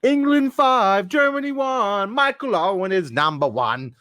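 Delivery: high, drawn-out terrace chant; loud, slow English fan chanting; soccer hooligan. Acted. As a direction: bellow it like a mock football hooligan. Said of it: "soccer hooligan".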